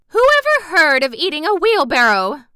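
loud, high, hammed-up disbelief; overly dramatic. A narrator's reading. Aunt reacting to the wheelbarrow breakfast, overly dramatic. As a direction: cry out as if utterly scandalized. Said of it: "Aunt Em being overly dramatic".

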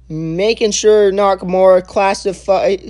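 ordinary delivery

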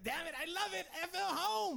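high, loud burst of joy; delighted. Retold or imitated. Speaker thrilled to hear home twang, high and loud. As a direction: squeal it out, thrilled. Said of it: "I was like".

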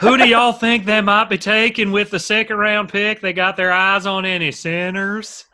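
hammed-up Southern lineman asking about the draft; Landon Dickerson impression. Podcaster imitates Landon Dickerson asking about picks, mock Southern accent. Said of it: "my impression".

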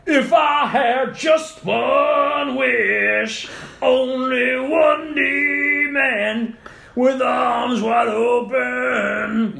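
high, loud, very slow grunge belt; nineties grunge voice. Pretend. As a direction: belt it like a nineties rock singer. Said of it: "nineties grunge voice".

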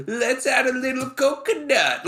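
high, jokey voice for the cooks; mock chef voice. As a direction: play the cooks as silly.

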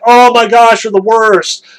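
mock-furious Moses; exasperated outburst. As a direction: groan it at the grumbling crowd.

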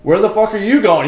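loud, high confrontation; angry challenge. Retold or imitated. Storyteller retells stopping the intruder, angry challenge. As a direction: demand it as if blocking someone's escape.

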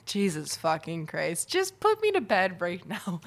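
ordinary talk

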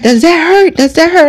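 high, probing grandma check-in; concerned grandmother. Retold or imitated. Grandmother pats the swollen eye, asking in a high voice. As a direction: ask it like a worried grandmother.

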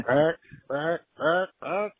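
mimicking frantic deer grunts; repeated grunt calls. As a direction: call the deer with rising grunts, one after another. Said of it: "I was like".

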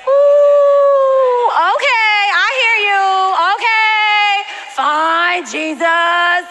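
high, loud wail settling into giving in; hammed-up reluctant obedience; mock whining surrender. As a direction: whine and give in. Acting out reluctant answer to God.

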